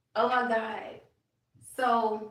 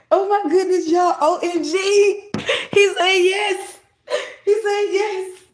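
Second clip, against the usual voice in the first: higher, louder, slow, laughing into tears; laughing and crying; 10 semitones higher, 12 dB louder, slower than usual. Real, not acted. Telling viewers the spirit said yes, laughing into tears. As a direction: overcome with joy.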